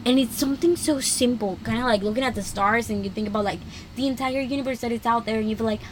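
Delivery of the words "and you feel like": ordinary delivery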